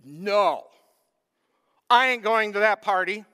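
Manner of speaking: sulky son shouting back; angry refusal. Acted. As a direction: slam the door on the invitation. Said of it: "he's angry".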